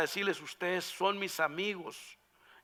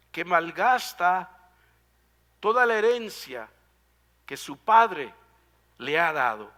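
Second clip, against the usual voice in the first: slow and louder, each phrase pushed out after a pause; louder, weighted phrases split by pauses; emphatic preaching; 6 dB louder than usual. Acted. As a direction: hammer home every phrase of the definition. Preacher drives home the definition.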